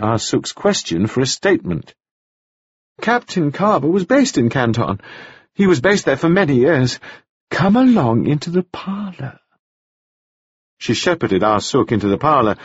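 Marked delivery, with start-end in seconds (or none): 3.0-9.4 higher, breezy, brisk society widow. A narrator's reading. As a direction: brush it off lightly. Mrs Wells.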